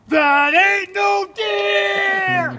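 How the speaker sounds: high, loud, slow yelled alarm; startled shout. Retold or imitated. Cole spots something in the brush and shouts. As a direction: bellow it out in sudden fright. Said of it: "as you heard Cole go".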